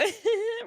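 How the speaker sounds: a high wet laugh breaking toward a sob; crying laugh, caught off guard; teary half-laugh half-sob. Real, not acted. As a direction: laugh through tears, rising at the end. Chat makes her tearful.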